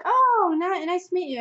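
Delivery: high, gushing mock greeting; mock friendly greeting. American meeting a stranger, mock friendly greeting. Mimics greeting before learning nationality.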